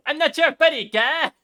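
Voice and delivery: high cartoon Canadian voice; loud, goofy Canadian cartoon voice; Terrence and Philip voice. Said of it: "Terrence or Philip".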